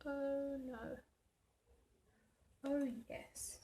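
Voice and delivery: ordinary delivery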